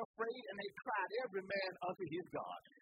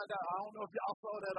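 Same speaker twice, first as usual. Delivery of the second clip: ordinary talk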